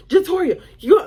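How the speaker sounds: high, loud scolding use of a full name; imitated motherly reprimand; mad mama. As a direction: call out the child's name like an angry mom. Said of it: "that's what she called me when she mad".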